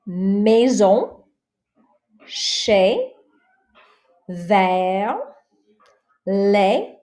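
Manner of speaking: each word alone with no final consonant; silent final consonants. Shown as a demonstration. Teacher modeling French words for students to repeat. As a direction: model every word slowly, dropping the last letter. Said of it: "listen carefully".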